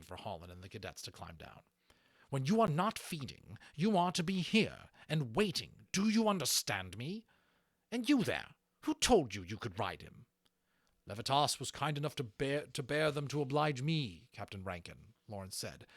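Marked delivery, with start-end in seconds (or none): 2.3-10.3 loud, high, harsh commands; angry orders. A narrator's reading. Rankin barking at cadets, loud and angry. 11.1-15.1 sharp, raised reply. A narrator's reading. Lawrence cutting in on Rankin, sharp and raised. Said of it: "speaking sharply to draw the man's attention".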